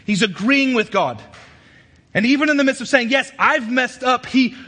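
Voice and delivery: full-force preaching. Acted. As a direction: drive each phrase home, high and loud.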